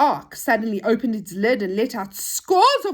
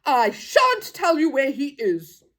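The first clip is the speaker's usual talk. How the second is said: slow, higher, stubborn refusal; cross policeman; 4 semitones higher, 4 dB louder, slower than usual. Acted. Policeman won't tell children where prisoner is, cross. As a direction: refuse crossly, dragging the words out. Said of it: "said the policeman crossly".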